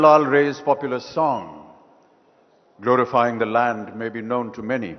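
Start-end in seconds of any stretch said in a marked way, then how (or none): none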